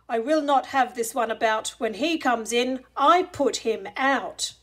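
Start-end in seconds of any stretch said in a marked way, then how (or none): none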